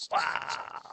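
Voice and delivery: breathless whispered wow. Retold or imitated. Stunned by the cold winter, whispered wow. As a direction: hush it out in disbelief. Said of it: "It's just like".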